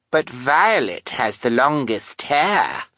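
put-on high, slow child voice; spiteful little girl. Carmelita volunteering Violet to the villains, spiteful. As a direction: pipe up, drawing out the words.

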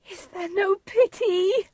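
very high, anguished appeal; high-pitched, pleading lament; Juliet. A narrator's reading. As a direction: wail it in despair. Juliet despairs after father's threat.

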